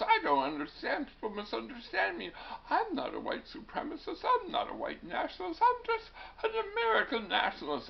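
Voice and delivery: high-pitched aggrieved protest; whiny, climbing imitation of a man excusing himself; mock defensive politician. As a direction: plead innocence in a squeaky mocking voice. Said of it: "because he goes".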